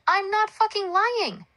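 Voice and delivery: high, slow, drawn-out angry shout; mad yell. A narrator's reading. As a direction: yell it indignantly, stretching the words. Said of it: "Alonzo got mad, then yelled out".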